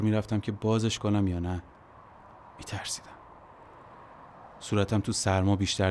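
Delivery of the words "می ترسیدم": hushed admission. A narrator's reading. Admits fear alone in truck.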